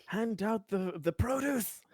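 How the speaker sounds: high, soft mock call; in-character order. Player ordering helper to give out produce, mock urgent call. Acted command to helper in game.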